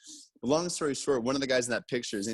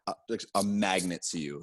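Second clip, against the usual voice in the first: usual speaking voice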